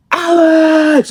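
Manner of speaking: high, loud call across the house; mom yelling his name. Retold or imitated. Mom calling her son from his room, a loud high yell.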